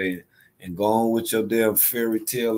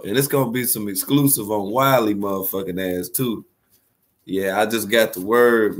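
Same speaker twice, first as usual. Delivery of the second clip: ordinary talk, nothing marked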